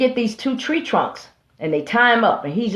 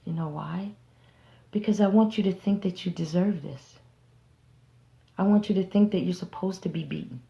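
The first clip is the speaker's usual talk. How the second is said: softer calm manipulator voice; 2 semitones lower, 9 dB quieter than usual. Acted. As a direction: speak gently while doing harm. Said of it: "talking to him in a calm voice".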